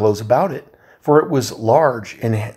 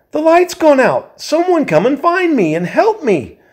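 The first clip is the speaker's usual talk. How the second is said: frightened call; 13 semitones higher than usual. Acted. Bilbo alone in the blackness begging the dwarves, high frightened plea. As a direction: call for help, courage failing. Bilbo lost in dark, calling.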